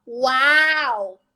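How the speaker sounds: long drawn-out wow; slow, marveling exclamation; awed wow. Retold or imitated. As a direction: let one word stretch out in awe. Awed reaction to God's words.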